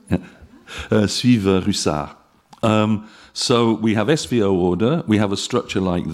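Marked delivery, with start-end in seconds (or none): 1.1-2.2 suivent Russard said the French way; French pronunciation, tentative; French accent. Shown as a demonstration. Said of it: "is that how you pronounce it".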